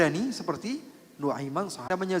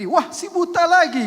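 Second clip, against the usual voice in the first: loud, higher cry of alarm; alarmed shout; 9 semitones higher, 9 dB louder than usual. Retold or imitated. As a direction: call out in dismay.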